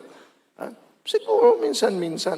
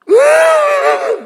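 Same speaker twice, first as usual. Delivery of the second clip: sudden wail shot up high and loud; loud wailing cry; 10 dB louder than usual. Shown as a demonstration. Preacher showing the congregation how weeping sounds. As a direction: demo of a grieving wail. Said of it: "May tunog".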